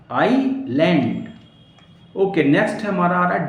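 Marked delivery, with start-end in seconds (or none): 0.1-1.2 "आइलैंड" slowly in two parts, "eye... land"; "आइलैंड" drawn out with a gap and no S; silent S, split up. Shown as a demonstration. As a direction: separate "आइलैंड" into two slow beats. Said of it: "क्या पढ़ेंगे".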